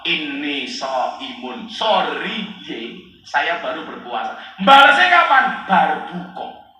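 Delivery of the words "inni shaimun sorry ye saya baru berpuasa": slow, soft, low apology; restrained fasting reply. Acted. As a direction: decline softly and slowly instead of hitting back. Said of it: "disuruh jawab".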